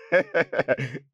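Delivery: helpless incredulous chuckling; scornful laughter. Acted. Son mocking the baby's name, scornful laughter. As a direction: keep laughing at the absurdity.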